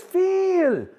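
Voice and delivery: put-on accented 'Phil' with a long ee vowel; Gloria. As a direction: call 'Phil' as a very high, drawn-out 'Feeel'. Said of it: "So she says".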